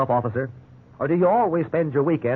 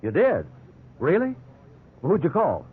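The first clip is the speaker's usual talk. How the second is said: hopeful surprise; 2 semitones higher than usual. Acted.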